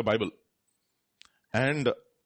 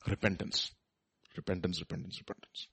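softer each time, down to a whisper; fading repetition; 6 semitones lower, 8 dB quieter than usual. Real, not acted. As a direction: let the word die away to a breath. Repeating the key word, fading.

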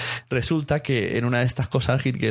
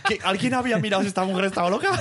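laughing; 9 semitones higher, faster than usual. Retold or imitated. Police asking, laughing.

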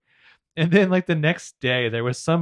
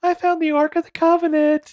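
excited Shower; 5 dB louder than usual. Retold or imitated. Voicing Shower while she digs.

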